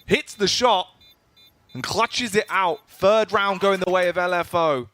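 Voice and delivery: high, loud hype call; esports caster. Real, not acted. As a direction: sell the clutch moment. Hype play-by-play.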